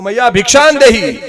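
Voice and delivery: high, loud begging call; wandering sadhu calling out for alms; sadhu's call. Retold or imitated. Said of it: "બહાર આવીને રાડ પાડી".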